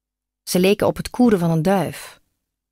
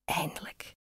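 whisper; 17 dB quieter than usual. A narrator's reading. Girl's breathless reply to love.

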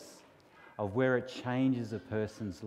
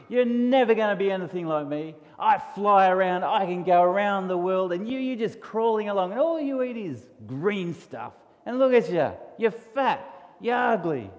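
high, loud bragging bug voice; boastful mean beetle; 6 semitones higher, 5 dB louder than usual. Acted. Beetle bragging to the caterpillar, high and sneering.

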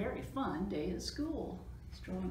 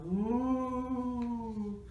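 long, higher ghostly wail; spooky ghostly ooh; 4 semitones higher, 4 dB louder than usual. A narrator's reading. Reader sounding noises behind the door, ghostly. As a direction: draw out a haunting ooh. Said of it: "More spooky sounds".

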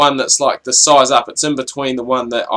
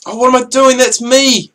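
surprised outburst; 10 semitones higher than usual. Real, not acted. Mistakes his player on screen.

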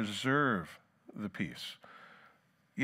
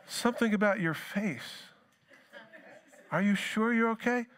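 much higher, sing-song prying voice; mock concern; 11 semitones higher than usual. Asker teasing a touchy person with mock worry. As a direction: feign worry to provoke.

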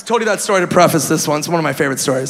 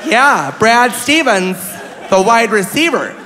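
slow, higher imitated old lady; insistent old woman; 4 semitones higher, slower than usual. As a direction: spell it out to disbelieving family. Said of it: "this poor old woman".

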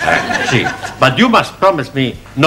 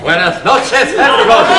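cheerful greeting; 11 semitones higher, faster than usual. Acted.

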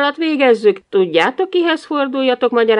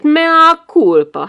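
sarcastic mea culpa; 8 semitones higher, 8 dB louder, much slower than usual. Acted. Husband's angry mock confession.

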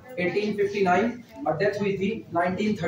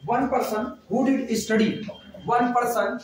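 very slow, word by word for writing down; slow dictation; 2 semitones higher, much slower than usual. Shown as a demonstration. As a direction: dictate it slowly so the class can write. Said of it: "आगे लिखना". (धीमे-धीमे लिखवाते हुए)

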